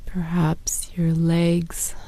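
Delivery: ordinary delivery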